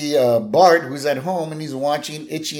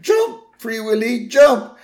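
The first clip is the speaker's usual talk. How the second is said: high, loud, drawn-out urging shout; hammed-up impassioned yell; excited cartoon cheering; 12 semitones higher, 5 dB louder, slower than usual. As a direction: cheer a movie hero on at the screen. Homer urging whale at the TV.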